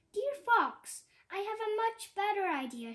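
high, loud, fast squawk; squawking hen. Acted. Hen squawking to Fox to save herself, high and loud. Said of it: "she squawked".

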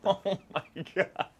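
high, drawn-out laughing exclamation. Real, not acted. Agent delighted by actor's accent line, laughing. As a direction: crack up in delight.

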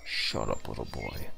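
low, soft mock growl; gruff mock voice. Dismissing the little boy character in a low mock voice. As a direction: sneer it down low.

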